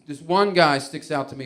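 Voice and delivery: normal talking voice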